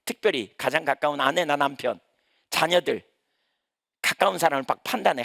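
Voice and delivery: ordinary talk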